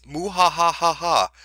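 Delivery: slow hammed-up villain laugh; evil laugh. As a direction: mwahaha like a cartoon overlord. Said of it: "your new community overlord".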